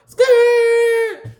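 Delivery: loud, very high squealing skirt; tire screech. Retold or imitated. Taking off from the ATM, loud high tire screech. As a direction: screech off like peeling tires.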